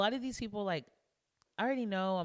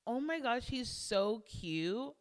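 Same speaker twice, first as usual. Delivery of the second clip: higher, slow, drawn-out cooing; retold gush, stretched out high; gushing over cuteness; 7 semitones higher, 3 dB louder, slower than usual. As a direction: coo as if over a baby. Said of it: "like, baby cute".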